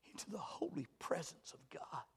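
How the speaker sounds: near-whisper, very soft; hushed reverence. Real, not acted. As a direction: breathe it out in holy awe. Hushed reverence after loud climax.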